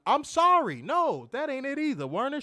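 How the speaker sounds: high, loud, hammed-up sorry; loud mock apology. Host apologizing to Werner again, loud mock sorry. Another wrong name, louder apology.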